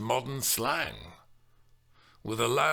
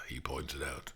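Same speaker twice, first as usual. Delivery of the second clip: plain reading voice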